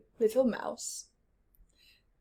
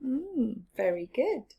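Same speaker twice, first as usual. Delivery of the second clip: ordinary delivery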